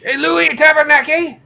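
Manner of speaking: high, loud French Canadian call; mock French Canadian. Clerk greeting a customer, French Canadian accent. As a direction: call out like a hammy Quebec clerk. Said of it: "broken French Canadian accents".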